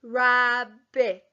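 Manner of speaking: rabbit sounded out in two beats; syllable by syllable. Shown as a demonstration. Teacher leads class sounding out rabbit. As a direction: say rabbit slowly in two claps.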